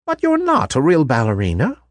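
put-on a kind old lady revealing a secret; kindly old toy maker.

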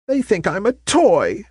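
high, hurt cry of dismay. Acted. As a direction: realize she is only a toy. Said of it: "she cried in dismay".

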